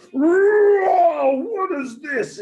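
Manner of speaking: high, loud startled whoa; lion caught in the net, startled and alarmed. Acted. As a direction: leap up in alarm.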